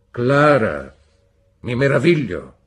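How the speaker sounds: a husband's cold indignation; stern rebuke. A narrator's reading. Husband scolding his wife, loud and severe.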